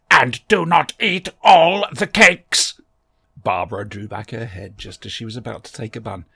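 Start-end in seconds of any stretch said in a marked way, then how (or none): 0.1-2.8 very slow, louder, grating robot voice; slow metallic Dalek. A narrator's reading. The Dalek invites Barbara to sit, slow, loud and metallic. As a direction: grind each word out like a machine. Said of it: "in its slow metallic voice".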